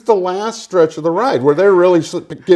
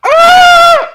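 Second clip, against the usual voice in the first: long high steady scream; constant scream; 9 dB louder than usual. Retold or imitated. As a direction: scream like a boat horn. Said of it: "she needed to take a breath so she could start screaming again".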